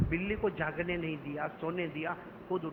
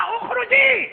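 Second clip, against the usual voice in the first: much higher, louder furious command; angry shout; 12 dB louder, much slower than usual. Acted. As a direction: throw her out with one furious word. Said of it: "غصے میں کھڑے ہوئے". (ابو ہریرہ عورت پر غصے سے چیختے ہیں)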